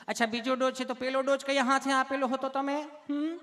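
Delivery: high put-on nurse voice; sweetly asking nurse. Nurse asking why he is nervous, high mock sweetness. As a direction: coo questions up high at the patient. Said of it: "તમે કેટલું પ્રેમથી પૂછો છો".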